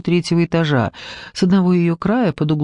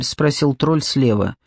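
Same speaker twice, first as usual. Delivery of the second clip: usual speaking voice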